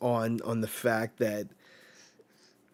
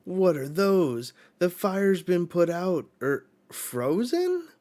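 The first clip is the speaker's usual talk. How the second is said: high, louder, slower amazed questions; astonished questioning; 7 semitones higher, 6 dB louder than usual. Retold or imitated. Killingham astonished as the fire is frozen. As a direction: puzzle over it in astonishment. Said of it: "Killingham's like".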